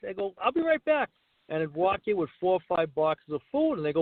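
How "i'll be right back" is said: high-pitched quick promise to customers; acted-out breezy salesman call; retold sales patter. Acting out salon cold-call pitch.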